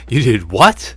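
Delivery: very loud disbelieving question; loud shocked outburst. Acted. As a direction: react in disbelief. Shock at self-destruct plan.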